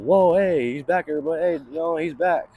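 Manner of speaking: mock the doctors celebrating his return; excited doctors. Doctors cheering to the room that he's back, excited. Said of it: "And they said".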